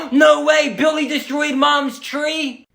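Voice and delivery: ordinary delivery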